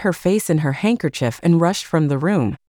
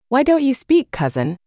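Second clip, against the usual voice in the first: sudden high shriek; lightly voiced shriek of a furious princess; a touch of a shrieking outburst; 7 semitones higher than usual. A narrator's reading. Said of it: "suddenly shrieked the princess".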